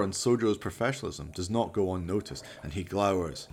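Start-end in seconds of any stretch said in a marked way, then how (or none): none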